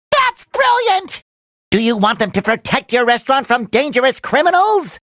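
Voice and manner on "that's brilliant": high, drawn-out excited cry; thrilled, high-pitched exclamation; delighted outburst. Acted. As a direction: burst out with glee, stretching it.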